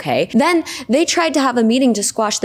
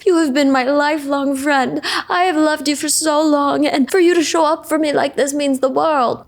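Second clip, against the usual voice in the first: higher-pitched, hammed-up weepy gushing; mock tearful; 6 semitones higher than usual. Friends hugging and making up at the bar mitzvah, mock tearful. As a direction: imitate an emotional, tearful reconciliation. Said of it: "there's tears".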